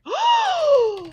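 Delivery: sharp high gasp; shocked gasp. Retold or imitated. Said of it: "And I'm like".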